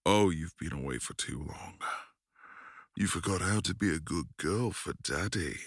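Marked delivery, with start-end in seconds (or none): none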